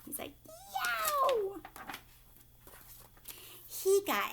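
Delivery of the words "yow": very high, drawn-out yowl without extra volume; mock pained yelp. Boy scaring his dad and sister, mock yelp. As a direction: imitate a startling high-pitched howl. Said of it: "he scared his dad and his sister".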